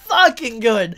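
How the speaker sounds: normal talking voice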